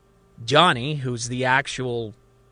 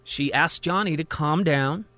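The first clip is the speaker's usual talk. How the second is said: usual speaking voice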